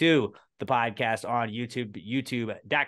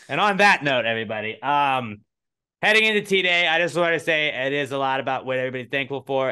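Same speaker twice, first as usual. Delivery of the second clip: loud announcer; 4 semitones higher, 6 dB louder than usual. Real, not acted. Sign-off announcement.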